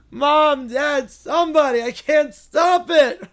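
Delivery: high, loud panicked shout, slowing at the end; kids screaming. Acted. Terrified cartoon kids scream for mom and dad. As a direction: scream for your parents in a panic. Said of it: "The kids start screaming".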